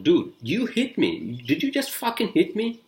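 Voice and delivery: retold accusation, climbing to high-pitched disbelief; worked up. Driver confronting the man who hit his car, worked up. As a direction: start drawn out, then shoot up high, incredulous. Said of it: "I'm fucking worked out".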